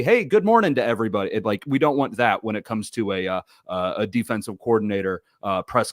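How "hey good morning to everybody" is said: mock cheery greeting. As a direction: imitate an overly cheerful coach greeting the room. Imitates an overly cheery coach greeting.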